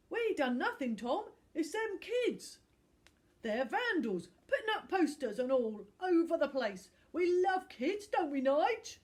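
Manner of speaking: loud rough-voiced lout; bully insisting to the footballer they love kids; shifty thug. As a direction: fake innocence, too loud. Thug Barry lies to Tom.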